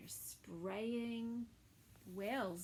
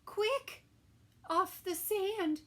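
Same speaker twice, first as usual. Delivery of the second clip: high urgent call; cried out; 11 semitones higher, 10 dB louder than usual. Acted. Snail urging the beached whale, high cry. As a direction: snail crying out to the stranded whale. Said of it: "cried the snail".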